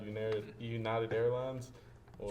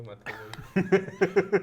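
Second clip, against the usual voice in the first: mock whiny pout; 8 semitones higher, 9 dB louder than usual. Mock pout over confiscated cutter.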